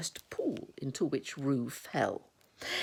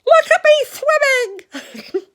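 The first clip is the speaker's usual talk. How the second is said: slow, very high loud squeal; bubbling, proud squeal; Roo; 15 dB louder, much slower than usual. Acted. Roo drifting downstream, proud high squeal. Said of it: "still bubbling proudly".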